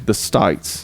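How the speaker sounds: slow "States" with an I sound; Australian pronunciation. Shown as a demonstration. Demonstrating Australian States, slowly said as "stites". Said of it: "like there's an I in there".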